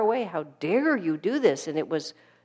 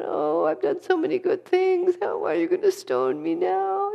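much higher, mocking whine; whining voice; 12 semitones higher, 3 dB louder than usual. Mocking how Jesus would not have answered the mob, in a high whine. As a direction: parody a self-pitying whiner. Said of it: "in a whining voice".

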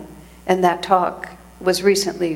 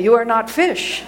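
higher, emphatic mock pronouncement; emphatic declaration; 5 semitones higher than usual. Speaker retelling the Swami's slip to amused listeners, emphatic. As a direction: declare it emphatically, on the edge of laughter. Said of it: "he had declared emphatically".

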